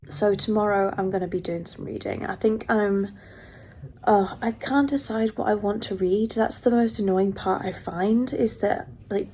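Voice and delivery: normal talking voice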